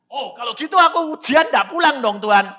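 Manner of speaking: high, loud and eager; excited bargaining with God; relieved acted reply. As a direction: burst out brightly to God.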